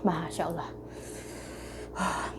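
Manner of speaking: puckered from a sour taste; eating sour candy. Real, not acted. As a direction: react through a sour mouthful. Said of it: "Asem banget".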